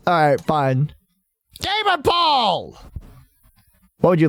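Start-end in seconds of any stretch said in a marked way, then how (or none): 1.6-2.8 drawn-out call. Real, not acted. Calling out to a viewer.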